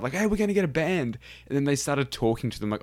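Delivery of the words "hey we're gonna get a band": high-pitched imitation of eager players; excited. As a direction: voice a thrilled group, pitched up. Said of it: "they were so excited".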